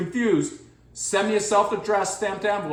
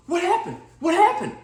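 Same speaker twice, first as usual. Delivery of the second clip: high, eager repeated question; excited wife; 8 semitones higher than usual. Retold or imitated. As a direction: ask it eagerly, like she rushed down. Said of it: "Karen comes down, all excited, going".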